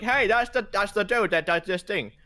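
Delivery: high, loud excited call; Finnegan. Acted. Game master voicing a character's discovery, high and loud. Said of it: "Finnegan was like".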